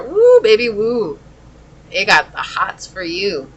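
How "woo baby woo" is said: high, loud teasing woo; playful whoop. Real, not acted. Teasing about strong attraction.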